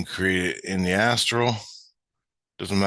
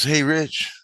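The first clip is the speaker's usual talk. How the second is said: higher-pitched imitation of a little kid; kid calling out; 9 semitones higher, 3 dB louder than usual. Kid greeting the train maker, imitated high voice. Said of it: "the kid goes".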